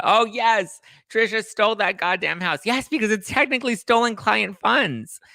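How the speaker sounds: high, loud gleeful gossip; gleeful outburst. Real, not acted. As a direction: squeal it with delight.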